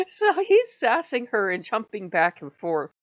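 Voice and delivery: usual speaking voice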